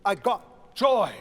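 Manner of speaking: "joy" punched loud after a slow lead-in; landing on JOY. Shown as a demonstration. Choir director showing where to land, strong "joy". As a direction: land hard and on time on "joy". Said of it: "I wanted to land on joy".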